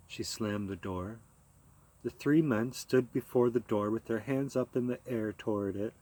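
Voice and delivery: ordinary delivery, nothing marked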